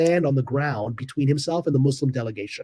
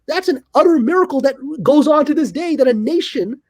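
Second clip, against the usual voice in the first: awed; 9 semitones higher than usual. Real, not acted. Speaker marvels at the miracle.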